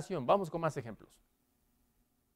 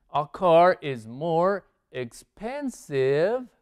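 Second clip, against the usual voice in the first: slow model sentence; much slower than usual. Shown as a demonstration. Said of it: "teacher builds the sentence".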